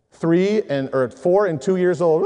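ordinary talk

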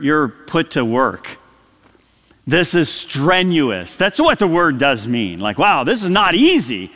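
ordinary talk, nothing marked

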